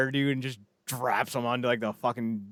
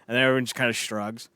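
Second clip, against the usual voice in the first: normal talking voice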